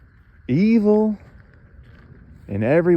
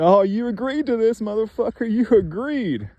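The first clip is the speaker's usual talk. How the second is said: higher, sneering taunt, slowing on the end; mock the victim, pitched up and jeering; imitating a taunting tormentor; 10 semitones higher than usual. Tormentors jeer at the man they are kicking, in a higher mocking voice. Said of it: "And they're saying".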